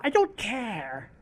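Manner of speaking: slow, quiet, dismissive drawl; a hint of a sulky rival voice; bored slow retort. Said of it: "Have them get into an argument".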